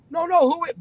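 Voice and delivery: sudden startled squeak; startled protest. Retold or imitated. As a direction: blurt it out like you've been ambushed. Startled on the phone.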